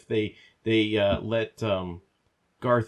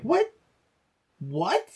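much higher, baffled what; incredulous what; 12 semitones higher than usual. Real, not acted. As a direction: throw up your hands, what. Outraged disbelief at dialogue.

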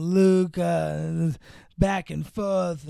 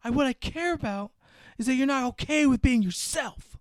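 mock earnest reply; 8 semitones higher than usual. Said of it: "And she goes".

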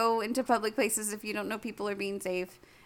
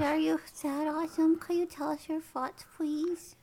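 put-on cutesy baby voice; 6 semitones higher than usual. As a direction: coo it like talking to a toddler. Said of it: "I can talk in a baby voice".

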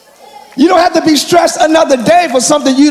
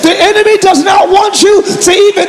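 high, full-force preacher shout; real excited pulpit yelling, pitched high; yelling, excited preaching; 7 semitones higher than usual. Real, not acted. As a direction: preach it at a fervent yell, building to the last word. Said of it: "I'm yelling".

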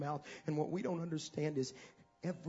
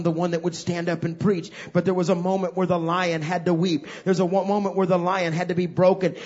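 building sermon; 4 semitones higher, 11 dB louder than usual. Acted. Sermon rises again.

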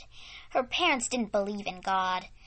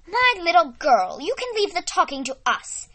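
higher, louder stern scolding; stern mother; 8 semitones higher, 8 dB louder than usual. Acted. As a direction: put the child in her place. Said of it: "said her mother sternly".

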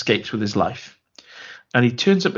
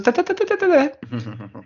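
very high run of da-da-das; sing-song entrance flourish, pitched way up; mock ta-da flourish. Fake father revealing himself as an actor, mock fanfare.

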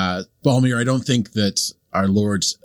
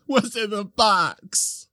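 much higher and very slow, drawn-out anguish; hammed-up anguished "What's in the box?"; desperate movie quote; 12 semitones higher, much slower than usual. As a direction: plead it like the famous film scene. Quoting the famous movie line.

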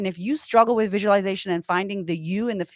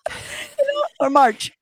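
laughing; 5 semitones higher, slower than usual. Real, not acted. Laughs mid-rant.

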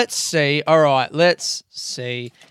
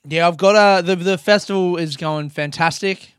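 ordinary talk, nothing marked

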